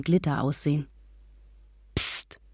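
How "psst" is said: whisper. A narrator's reading. Girl secretly calling her friend in the chapel, whispered. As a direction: hiss for attention in a whisper. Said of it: "Das hatte ein Flüstern sein sollen".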